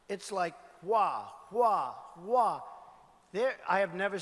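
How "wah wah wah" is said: drawn-out mock wah imitating a spike; mock siren wah. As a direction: stretch each wah like a cartoon siren.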